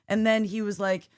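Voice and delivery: ordinary talk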